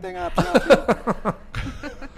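high, very slow mock-annoyed plea, breaking into laughter; wife complaining. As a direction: play the fed-up wife, laughing. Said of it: "She's like".

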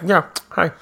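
high, offhand cartoon-style greeting; Bugs Bunny. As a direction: greet them casually between munches. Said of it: "he's like Bugs Bunny".

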